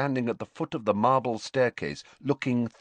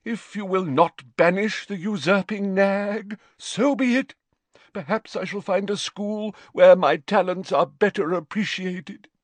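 very high, melodramatic injured voice; wounded dignity, high-pitched and theatrical; deeply wounded; 11 semitones higher, 3 dB louder than usual. A narrator's reading. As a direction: declaim it like a slighted seer. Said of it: "in a deeply wounded voice".

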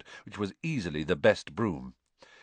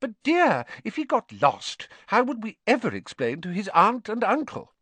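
much higher anxious mother voice; higher worried mum; Mrs Weasley, worried; 13 semitones higher, 4 dB louder than usual. A narrator's reading. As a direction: fret over what could go wrong.

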